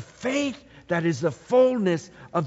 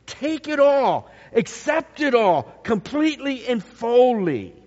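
louder, higher, pressing every word; emphatic urging; 5 semitones higher, 4 dB louder than usual. Real, not acted. Preacher urging the congregation to accept fully, emphatic. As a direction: urge them to take it all.

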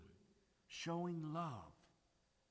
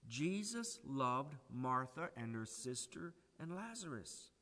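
ordinary talk

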